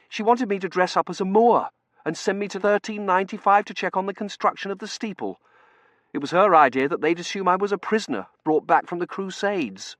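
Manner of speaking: high, loud account; aggrieved young junior. Acted. T J recounting Lady Shrapnel's plan, high aggrieved voice.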